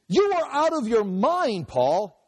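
high-pitched exclamation, drawn out; mock shout. A narrator's reading. Roman governor interrupting Paul's defense, mock shouting. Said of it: "he shouted".

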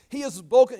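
loud, high public accusation; playing outraged. High priest playing out the role, feigned outrage. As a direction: act scandalized for the room. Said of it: "he's just playing out the role".

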